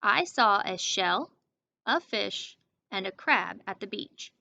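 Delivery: slow read, a clear break after shell and after fish; slow down and pause at each comma; pausing at commas. Shown as a demonstration. Teacher rereading the sentence, pausing at commas. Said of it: "I'm gonna do it one more time".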